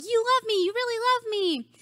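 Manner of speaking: high, thrilled mock gush; mock gushing quote. People joking about the famous line, high and gushing. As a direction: overdo the delight like a parody. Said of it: "people will joke around and say it".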